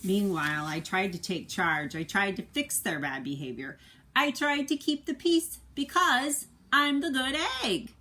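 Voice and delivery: storybook voice for the good egg, earnest; reading a picture book aloud. A narrator's reading. Parent reading a picture book to a child, lively storybook voice. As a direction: read it like a bedtime story to a child.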